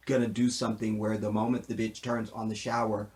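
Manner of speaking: ordinary talk, nothing marked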